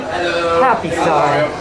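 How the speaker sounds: plain reading voice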